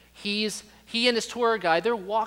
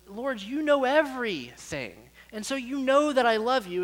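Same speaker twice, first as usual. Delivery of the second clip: acted hurt, pleading to be believed; grieved Peter; 7 semitones higher than usual. Disciple answering Jesus the third time, grieved. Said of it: "the scriptures say that Peter was grieved".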